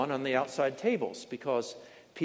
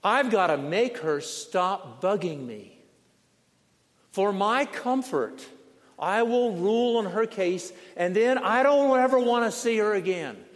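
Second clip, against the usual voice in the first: exasperated unjust judge; 5 semitones higher, 5 dB louder than usual. Acted. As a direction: spell out your irritation, rising to a forceful finish. Unjust judge, worn out by widow.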